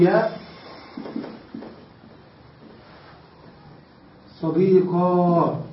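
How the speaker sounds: صديقا drawn out very slowly, ending in a long ā; slow careful صديقا ending in ā; ya turned to alif. Shown as a demonstration. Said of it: "نبدل هذه الياء ألفا".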